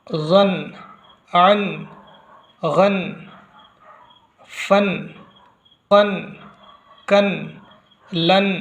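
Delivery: each syllable sounded whole, then a pause; rawan reading drill. Shown as a demonstration. Qaida teacher reading two zabar on sight, rawan drill. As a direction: read on sight, one firm syllable at a time. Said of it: "रवां की आदत डालनी है".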